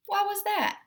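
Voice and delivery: high, puzzled diva cat; a vain cat asking, intrigued; Edith, curious. A narrator's reading.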